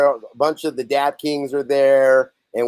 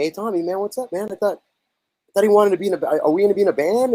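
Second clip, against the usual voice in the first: retold tentative pestering; 6 semitones higher than usual. Band asking their go-between about the deal, retold tentative pestering. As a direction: imitate an unsure musician nudging for an answer.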